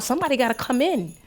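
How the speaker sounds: normal talking voice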